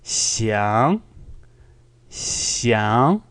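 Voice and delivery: "Xiang" rising smoothly, no croak; smooth second tone. Shown as a demonstration. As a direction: glide "Xiang" steadily upward. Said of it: "more of a smooth rising".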